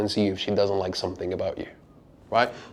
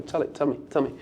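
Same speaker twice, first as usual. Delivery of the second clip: softer, playful coaxing; coaxing softly; 3 semitones higher, 8 dB quieter than usual. Shown as a demonstration. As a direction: draw the secret out of her gently. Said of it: "just whisper in my ear".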